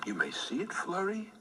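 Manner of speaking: usual speaking voice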